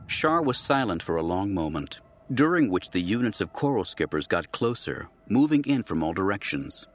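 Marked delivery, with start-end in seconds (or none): none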